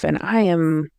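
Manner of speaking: ordinary talk, nothing marked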